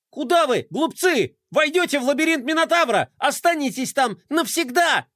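high, fast villain yell; Melkotron shouting. A narrator's reading. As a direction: shout a taunting threat. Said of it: "закричал им вслед Мелкотрон".

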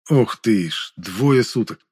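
ordinary delivery, nothing marked

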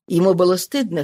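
plain reading voice

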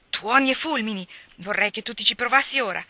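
loud, indignant outburst; righteous anger. A narrator's reading. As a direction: shout it in protective fury. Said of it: "esclamò Ned ... giusta collera".

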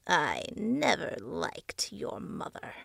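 slow, voiceless creepy whisper; slow eerie whisper; the face, whispering. A narrator's reading. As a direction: breathe it out like a lurking thing. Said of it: "the face said".

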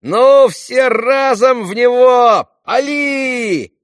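very high, slow shouted command; battle cry. Acted. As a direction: yell the order to fire, stretched out. Boys order a volley. (протяжный крик-команда)

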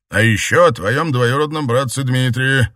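dark lord. A narrator's reading. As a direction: threaten coldly. Emperor blackmails.

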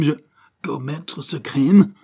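scornful slowed-down echo of commettre ce crime; mocking echo. A narrator's reading. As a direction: drag out commettre ce crime sarcastically. Dawes mocking repeat.

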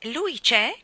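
hurried. A narrator's reading. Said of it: "chiese Michael in fretta".

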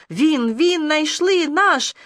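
high, drawn-out creature cry; screeching creatures. A narrator's reading. Creatures close in on the boy they found, screeching. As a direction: stretch it out high, mildly screechy. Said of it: "Скрежеща".

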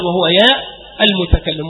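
ordinary delivery

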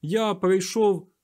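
slow, high, loud declaration; fervent, drawn-out address; proclamation. A narrator's reading. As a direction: proclaim it slowly to the crowd. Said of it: "звернувся до близнюків дикун".

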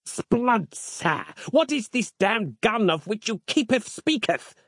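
high, archaic zealot's exclamation; high-pitched theatrical indignation; crying out indignantly. A narrator's reading. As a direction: cry it like an affronted old-time soldier. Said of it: "cried Harland".

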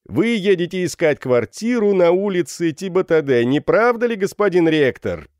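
high, loud mocking question; thunderous jeering. A narrator's reading. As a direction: roar the pun at the victim. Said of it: "громовыми голосами". (громовым голосом, насмешливо)

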